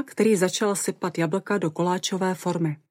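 plain reading voice

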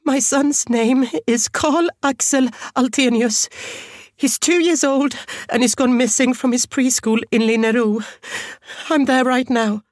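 high, distraught voice held in check; strained composure. A narrator's reading. Mother giving police her son's details, forcing composure. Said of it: "trying her best to sound composed".

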